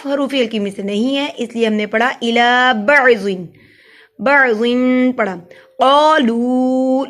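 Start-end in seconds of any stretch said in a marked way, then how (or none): 4.2-4.8 "بعضن" slowly, final n held in a hum; ghunna. Shown as a demonstration. Teacher showing ghunna, "بعضن" with a long nasal n. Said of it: "پہ گنہ ہوگا". 5.8-7.0 "قالو" chanted very slowly, both vowels held; elongated vowels. Shown as a demonstration. Teacher showing long vowels, "قالو" stretched slowly. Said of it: "لو کو لمبا کیا".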